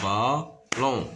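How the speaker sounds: "ballon" in two separated beats; stretched "ballon" with a gap; syllable by syllable. Shown as a demonstration. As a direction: sound out "ballon" as ba, pause, lon. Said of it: "se compose de deux syllabes".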